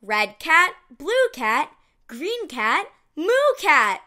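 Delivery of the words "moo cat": usual speaking voice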